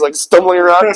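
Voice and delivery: normal talking voice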